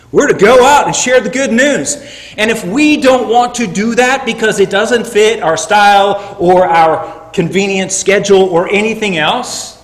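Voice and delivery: loud, high, driving sermon delivery; fiery preaching. Acted. Preacher urging the church to share the gospel, loud and fervent. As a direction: preach it hard to the congregation.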